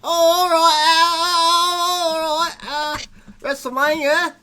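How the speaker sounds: high, over-the-top mock British English; British accent impression. Egged on by the room, a hammed-up British accent. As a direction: ham up a posh British accent. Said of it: "you can do your British English impression".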